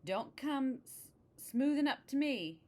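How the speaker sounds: high-pitched scolding protest; crying out. A narrator's reading. Witch rebuffs the soothing cat, high scolding cry. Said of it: "she cried".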